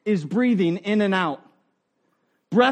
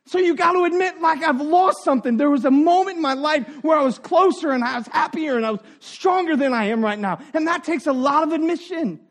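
usual speaking voice

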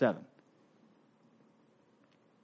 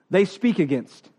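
ordinary talk

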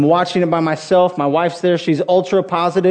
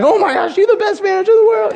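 much higher and fast, laughing with glee; giddy praise; 14 semitones higher, faster than usual. Retold or imitated. Fan praising the manager after Berkman's hit, high fast and laughing. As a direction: gush like a kid whose team just came back. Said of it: "and you're like".

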